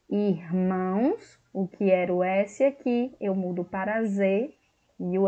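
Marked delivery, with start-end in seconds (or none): 0.1-1.4 irmãos drawn out slowly; slow demo word. Shown as a demonstration. Teacher building the diminutive from the plural, slow. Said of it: "então eu vou fazer aqui".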